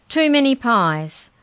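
plain reading voice

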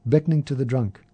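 ordinary delivery, nothing marked

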